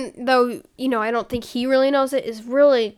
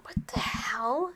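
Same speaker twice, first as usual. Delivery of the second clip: whispering; 12 dB quieter than usual. Real, not acted. Startled by a photo on screen.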